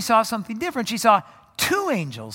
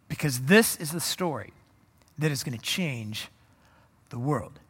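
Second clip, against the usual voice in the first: earnest charge; 7 semitones lower, 7 dB quieter than usual. Acted. Jesus sending his disciples out, low and earnest. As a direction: speak it like a quiet solemn promise.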